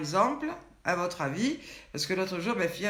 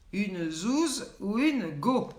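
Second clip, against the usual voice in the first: very slow, stretched teasing question; game host teasing the viewers, stretched out; drawn-out quiz question; much slower than usual. Acted. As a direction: dangle each word like a riddle.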